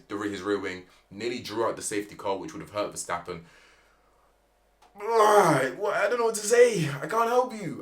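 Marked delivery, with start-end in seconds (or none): 5.0-5.8 high, loud fed-up ugh; loud exasperated high groan. Real, not acted. Reacting to crash. 5.8-7.8 high, loud despairing cry; fed-up high shout; exasperated shout. Real, not acted. Despairing at driver's crash.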